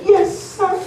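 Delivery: put-on high, timid little boy's yes sir. Boy answering girlfriend's dad, high timid voice. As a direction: shrink it down like a scared kid.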